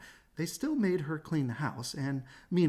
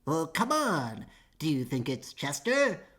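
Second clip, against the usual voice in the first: coaxing little man; 2 semitones higher, 3 dB louder, slower than usual. Acted.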